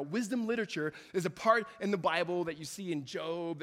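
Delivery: normal talking voice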